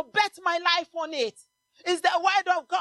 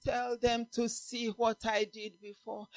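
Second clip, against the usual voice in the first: quiet voice of God; 5 semitones lower, 10 dB quieter than usual. Acted. God's voice quoted softly.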